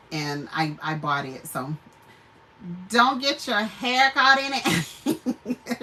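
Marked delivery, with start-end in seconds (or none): 2.9-5.8 slow, laughing through the words; laughing warning. Real, not acted. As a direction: chuckle while you warn them. Laughing warning about the shirt.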